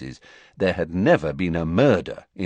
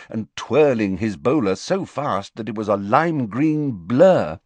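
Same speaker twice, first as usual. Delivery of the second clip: ordinary talk, nothing marked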